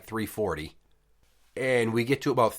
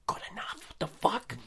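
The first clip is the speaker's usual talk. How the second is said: incredulous; 10 semitones higher, 8 dB quieter than usual. Real, not acted. Incredulous reaction to doctor.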